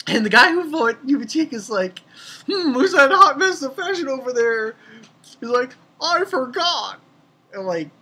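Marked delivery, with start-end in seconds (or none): 2.5-4.8 character voice. Retold or imitated. Voicing a Bleach character. 6.0-7.0 character voice. Retold or imitated. Voicing the character's reply.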